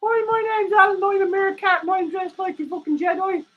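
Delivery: high squeaky meerkat puppet; high puppet voice for Alan. Acted. Streamer voicing his meerkat puppet, high and cheeky. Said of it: "Alan wants to say hello".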